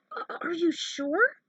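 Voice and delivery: a small worried voice asking; nervous stammer. Acted. Character stammers, unsure.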